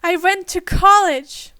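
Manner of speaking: loud, high indignant snap; angry outburst. A narrator's reading. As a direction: bristle with offended pride. Starts to turn red.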